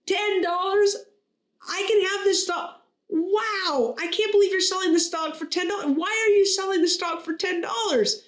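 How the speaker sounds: high-pitched, hammed-up amazement; astonished, voice pitched well up throughout; incredulous customer. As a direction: play a joke's buyer who cannot believe the bargain. Joke customer astonished at price.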